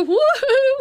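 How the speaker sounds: high, loud sing-song call; calling out like mama; a bright mock call for help; mama's woo-hoo. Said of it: "she'd go".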